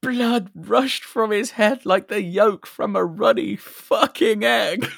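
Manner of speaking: high, drawn-out loud scream. Acted. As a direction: scream it out, stretching the words. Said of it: "Bobby screams".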